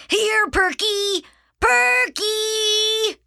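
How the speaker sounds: high, drawn-out call for a lost dog; loud singsong summoning. Acted. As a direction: holler the pet's name, stretching it long. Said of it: "called Arthur".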